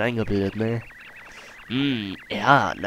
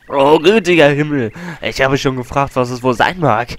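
big, loud, aggrieved voice; loud wailing whale; 3 semitones higher, 6 dB louder than usual. Acted. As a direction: boom out the whale's complaint. Voicing the whale and Colorado.

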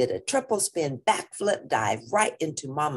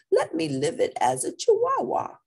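mock Spanish accent. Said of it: "using his very best Spanish accent".

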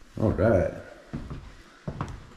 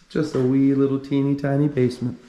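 cutesy; 6 semitones higher than usual. Acted. Cutesy remark on tiny basement.

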